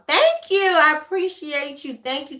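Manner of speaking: real delight at the compliment; delighted thanks. Real, not acted. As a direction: gush a thank-you to a fan. Viewer compliments her.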